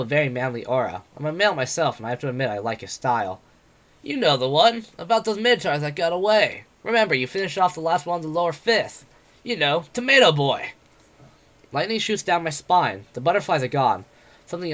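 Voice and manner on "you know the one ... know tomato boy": higher, louder mocking brawler voice; brash, jeering tavern boaster; cocky werewolf adventurer. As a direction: taunt him like a swaggering bully. Bete voiced in the story.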